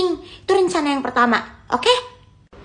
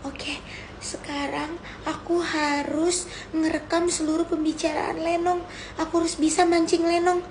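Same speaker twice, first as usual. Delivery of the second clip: quiet self-talk; 8 dB quieter than usual. Acted. Nervous self-talk before the plan.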